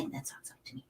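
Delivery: very soft, unvoiced aside; whispered aside. Real, not acted. Host slipping in an aside mid-story, whispered. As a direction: drop to a quick whisper.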